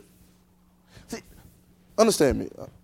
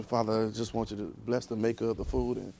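low, soft, mumbled table prayer; mock long-winded prayer; 5 semitones lower, 7 dB quieter than usual. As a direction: imitate a rambling blessing over the food. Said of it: "You know, we used to".

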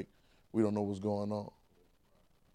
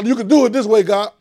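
praying demand; 6 semitones higher, 10 dB louder than usual. Retold or imitated. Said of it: "when I decide to say".

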